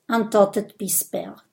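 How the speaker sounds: plain reading voice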